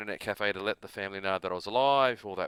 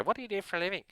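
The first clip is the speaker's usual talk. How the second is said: mock American woman; 10 semitones higher, much faster than usual. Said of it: "go up to me and go".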